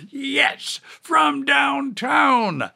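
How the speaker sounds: booming play-by-play shout; sportscaster call. Pretend. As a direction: call it like a buzzer-beater. Host imitates sportscaster call.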